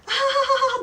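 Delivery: very high, loud cackling laugh; mom's hearty laugh. Retold or imitated. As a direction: burst out laughing like an amused grandma. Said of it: "막 웃으세요".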